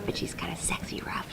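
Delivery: very soft, voiceless whisper; whispered aside. Real, not acted. Actress describing her character as sexy rough, whispering. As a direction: drop suddenly to a confiding whisper.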